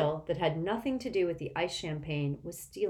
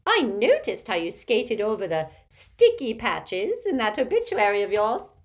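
loud, domineering Auntie Muriel character voice; imperious tipsy old aunt; 6 semitones higher, 7 dB louder than usual. A narrator's reading. Aunt greeting Harry at the table, imperious and loud. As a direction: project like a tactless old relative.

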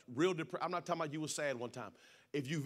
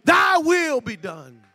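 raised, loud testimony building to a full shout; fervent pulpit climax; impassioned preaching; 7 semitones higher, 18 dB louder than usual. Real, not acted. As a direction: preach at full force, driving each phrase higher and louder.

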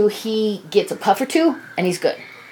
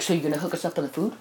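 softer, fast mock voice of a freeloader; mimicking the friend; 5 semitones lower, 10 dB quieter, faster than usual. Imitating the friend asking for a hookup, quick mock voice. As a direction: rattle it off like a guy angling for free food. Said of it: "he says is like".

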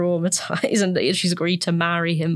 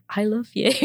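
higher, lightly acted lovestruck line; oblivious; 4 semitones higher than usual. Dorothy answering pleading Edmund, mildly oblivious adoration. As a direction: coo it lightly, ignoring any plea. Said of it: "oblivious to what he's saying".

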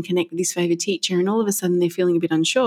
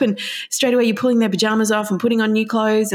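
ordinary delivery

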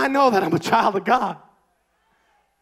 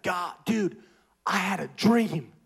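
lightly voiced laid-back surfer dude; a hint of a Californian surfer drawl; slower than usual. Soldier telling his dream in a light surfer voice. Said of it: "I don't know why I'm talking like a surfer".

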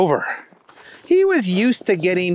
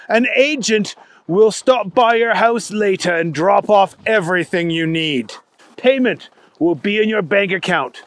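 businesslike British spy chief; brief the agent in a put-on British accent; weird British accent. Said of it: "a pretty weird accent".

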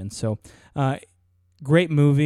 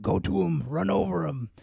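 higher-pitched impression of a boxing trainer; Mick; 5 semitones higher than usual. Podcast host quoting the trainer's flashback, Mick impression. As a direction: urge the fighter on in character. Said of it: "is one of Mick saying".

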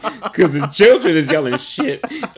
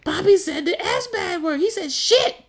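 put-on high, gleeful child voice; imitating a tattling kid; 12 semitones higher than usual. Dad voicing his son telling on him: high, gleeful child voice. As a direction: tell on dad like a delighted little kid. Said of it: "T J's like".

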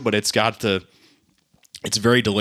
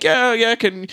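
mock neighbor voice; 12 semitones higher, 3 dB louder, much slower than usual. Neighbor quoted, rooster chase.

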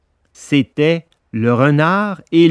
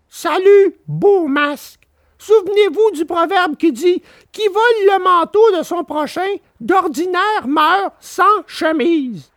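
high puppet voice, cheeky farewell; Pinocchio mocking. A narrator's reading. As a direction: brush off the swindlers. Pinocchio lectures them.

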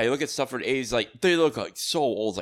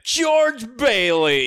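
slow, much higher and louder name; hammed-up drawl of the name; imitating a smug caller; drawn-out greeting; 11 semitones higher, 6 dB louder, much slower than usual.

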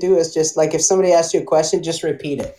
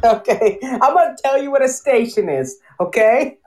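higher mock New York, teasing; New York accent; 6 semitones higher than usual. Mock New Yorker teasing a tourist, light New York accent. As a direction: drag out the promise New York-style. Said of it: "a New York accent".

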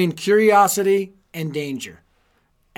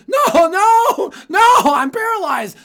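put-on high, loud panicked yell of a scared kid; retold childhood terror, shrieking high and loud; panicked screaming; 14 semitones higher, 10 dB louder, slower than usual. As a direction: scream it like you think you're paralyzed. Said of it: "I started screaming".

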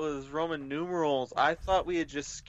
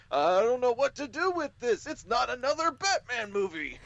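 baffled moviegoer; 10 semitones higher than usual. Retold or imitated. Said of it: "And they're like".

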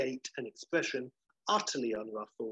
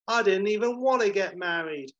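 higher, louder bridal whinge; fed-up bride; 8 semitones higher, 5 dB louder than usual. Retold or imitated. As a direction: complain like a reluctant bride. Fed-up bride complains at wedding.

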